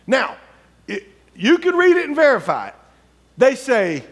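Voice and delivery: high, ringing sermon storytelling; preaching at full force. Acted. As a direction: drive the Bible story to the church. Preaching continues.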